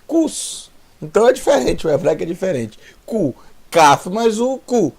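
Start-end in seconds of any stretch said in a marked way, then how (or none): 0.1-0.7 cus said high and drawn out with a clear final s; Hebrew pronunciation. Shown as a demonstration. Teacher models Hebrew cus against Portuguese cu, drawn out. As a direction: stretch cus so the class hears the s. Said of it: "como no português".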